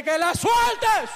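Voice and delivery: high, slow, drawn-out shouted order; commanding shout. Retold or imitated. Preacher orders the devil to release the girl, shouting. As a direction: shout it as an exorcist would. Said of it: "más le grité al diablo".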